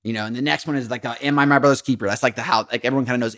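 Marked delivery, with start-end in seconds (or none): none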